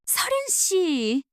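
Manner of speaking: slow, drawn-out startled call; surprised cry. Acted. Seojun sees Seolhyeon arrive, surprised cry. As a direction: call her name in shock. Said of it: "최서준이 놀란 목소리로 외쳤".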